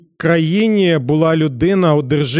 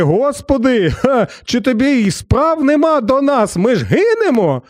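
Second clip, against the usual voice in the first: mock panicked cry; 6 semitones higher than usual. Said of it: "і вони починають волати".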